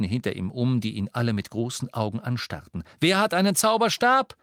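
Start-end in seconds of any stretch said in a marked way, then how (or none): 3.0-4.3 high, loud question to a group; calling out. Acted. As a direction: ask the whole crowd. Harry calls out to crowd.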